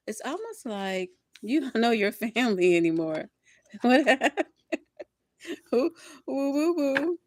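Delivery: loud, high, laughing whoops; loud laughing whoops at the family reading; excited outburst. Real, not acted. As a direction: let it burst out with laughter.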